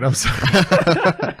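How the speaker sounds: slow, laughing through it; giggling while saying sorry; laughing apology. Real, not acted. Apologizing for the eating sound.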